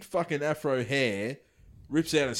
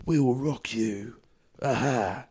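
Jason Statham; 3 semitones lower, slower than usual. Acted. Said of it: "More of a Jason Statham".

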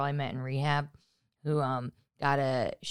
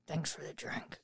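whispered thanks; 12 dB quieter than usual. Retold or imitated. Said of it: "I go like this".

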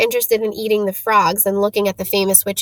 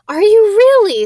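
excited disbelief; 11 semitones higher, 9 dB louder than usual. Acted. Hermione excited meeting Harry Potter.

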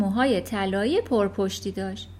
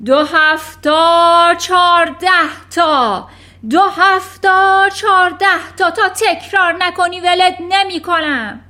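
bellow; 7 semitones higher, 13 dB louder than usual. Acted. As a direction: hammer each number into him. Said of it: "هوار کشید". (هوار کشیدن خشمگین و کشدار)

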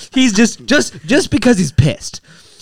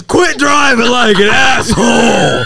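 drawn-out angry shout, pitched up; pissed-off dad; 4 semitones higher, slower than usual. Retold or imitated. Dad confronting a neighbor over his driving, pissed-off shout. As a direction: shout it slowly, stretching the last word. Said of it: "And my dad's pissed".

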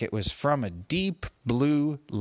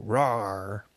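lion roar; 3 semitones lower than usual. Acted. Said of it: "roar at the beginning and at the end".

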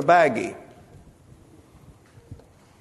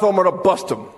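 ordinary talk, nothing marked

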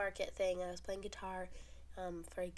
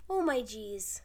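momish exclamation; 8 dB louder, slower than usual. Retold or imitated. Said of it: "It was on the level of".

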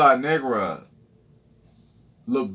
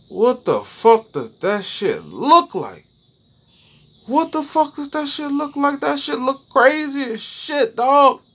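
high, frantic squeal of panic; shrieking in alarm at what he sees; panicked freakout; 12 semitones higher, slower than usual. Retold or imitated. A man staring at a rash in panic, high frantic squeal.

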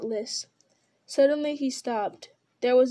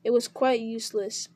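usual speaking voice

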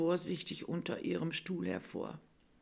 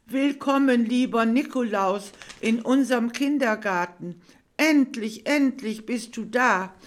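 put-on much higher, much louder child reciting; overly loud recital; 7 semitones higher, 10 dB louder than usual. Bella reciting her welcome poem to Nikolaus, far too loud. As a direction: shout the poem out eagerly like a nervous child. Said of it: "mit überlauter Stimme".

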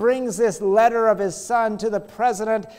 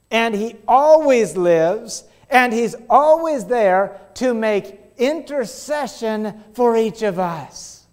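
slow emphatic; 4 semitones higher, 5 dB louder, slower than usual. Real, not acted. Preacher emphasis, slowed.

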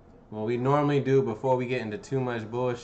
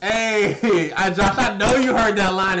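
laughing; 7 semitones higher, 6 dB louder, slower than usual. Real, not acted. Laughing while teasing Josh.

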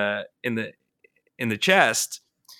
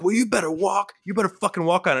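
threatening homeless man; 8 semitones higher, faster than usual. Retold or imitated. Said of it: "he's like".

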